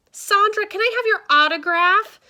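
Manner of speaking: high, loud fan's call. A narrator's reading. Missus Martinez begs a star for autograph, calling out. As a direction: shout it eagerly across a crowd. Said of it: "Missus Martinez called out".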